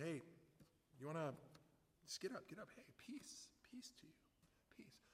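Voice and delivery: whispered, gentle reassurance acted out; very soft, discreet coaxing; hushed calming. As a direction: soothe someone privately, barely voiced. Retells quietly calming the man.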